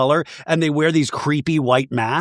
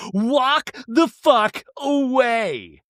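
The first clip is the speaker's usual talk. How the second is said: higher, very slow, loose and boozy; tipsy Heather impression; 8 semitones higher, much slower than usual. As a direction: slur it like she's had a few wines. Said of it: "glass of wine in her hand going".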